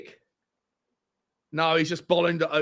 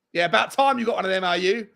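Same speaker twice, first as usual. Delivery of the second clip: ordinary talk, nothing marked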